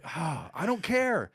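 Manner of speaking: acted weary dismissal of the gushing fans; exasperated indifference. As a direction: let out a long "ah", then dismiss them. Own exasperated reply to excited audience.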